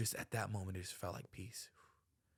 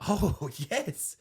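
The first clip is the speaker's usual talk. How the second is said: laughing yes; 8 semitones higher, 6 dB louder than usual. Real, not acted. Laughing agreement with co-host.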